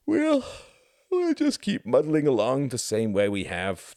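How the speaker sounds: said through a loud high yawn. A narrator's reading. Husband to Ruth at bedtime, yawning as he answers. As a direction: stretch the words out mid-yawn. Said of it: "he yawned".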